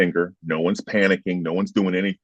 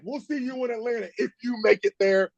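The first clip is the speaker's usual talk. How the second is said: a lightly voiced high taunt, softer; 13 semitones higher, 4 dB quieter than usual. Player taunting rivals after the loss, lightly mock cocky. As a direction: toss a cocky parting threat. Trash talk walking off field.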